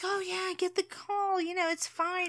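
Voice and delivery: high, breezy boss; airy, everything-is-fine boss; relaxed boss voice. Retold or imitated. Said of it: "But the boss is like".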